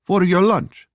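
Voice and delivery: high-pitched Russian waitress. A narrator's reading. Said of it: "the waitress said to Phoebe in Russian".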